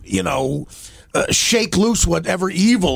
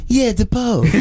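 higher, mock condescending drawl with a laugh; 6 semitones higher, slower than usual. Boss talking down to the new guy, mock condescending. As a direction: talk down to him like he's slow. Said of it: "he'd get real condescending".

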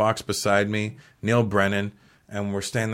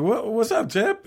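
squeaky, much higher starstruck hello; excited greeting; 13 semitones higher, slower than usual. Retold or imitated. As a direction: greet your hero, overexcited. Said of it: "I'm like".

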